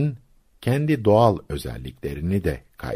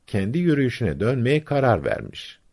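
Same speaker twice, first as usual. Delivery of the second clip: plain reading voice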